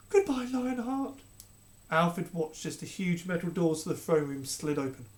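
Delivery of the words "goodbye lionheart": slow, high sorrowful goodbye; mother parting from her son; tender farewell. Acted. Queen's farewell.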